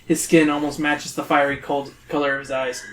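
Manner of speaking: ordinary talk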